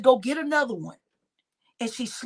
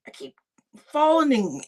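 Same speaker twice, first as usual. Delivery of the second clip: ordinary talk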